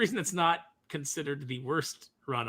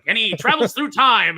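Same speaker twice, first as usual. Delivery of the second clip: much louder and higher; excited pitch; 8 semitones higher, 13 dB louder than usual. Retold or imitated. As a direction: sell the big twist. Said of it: "they go".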